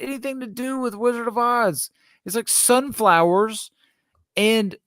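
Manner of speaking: high, slowed exasperated venting; frustrated rant. Real, not acted. To cohost about Nike's Oz dunks, frustrated high rant. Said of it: "I'm frustrated by the principle".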